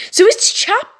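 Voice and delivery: high startled question; surprised. Real, not acted. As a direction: realize the mistake aloud. Realizes wrong chapter.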